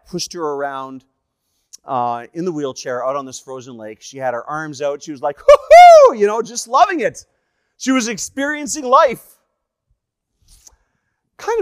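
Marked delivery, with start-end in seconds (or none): 5.4-6.2 very high, loud "hoo-hoo" whoop; joyful whoop. Retold or imitated. Wife pushed round a frozen lake, whooping loudly. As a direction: whoop it out in delight.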